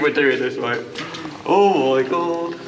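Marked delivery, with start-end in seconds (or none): 1.5-2.6 drawn-out, higher exclamation of awe; real amazement. Real, not acted. Explorer taking in the mad place, amazed. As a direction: stretch it out, stunned by the sight. Awed entering the mine.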